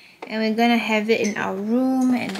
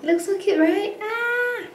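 excited; 10 semitones higher than usual. Real, not acted. Excited about new jacket.

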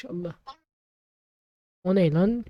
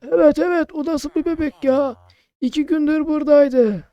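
put-on high loud old man voice; old shopkeeper voice; 8 semitones higher, 7 dB louder than usual. Player voicing shopkeeper Ahmet, high and loud. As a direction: answer like a hearty old uncle.

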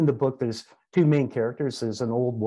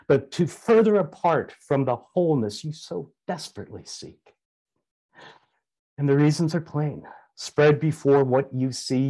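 put-on raised, impassioned reading of a grieving young woman's lament; dramatic soliloquy reading; 4 semitones higher than usual. Reading Samosa's soliloquy aloud to the gathering, impassioned and declamatory. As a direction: declaim it as Samosa mourning the world's madness. Reads soliloquy from her book.

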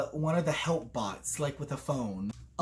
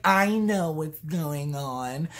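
slow, lower mocking voice; smug know-it-all; 4 semitones lower, slower than usual. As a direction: draw it out like a smug book reader. Mimicking smug book readers.

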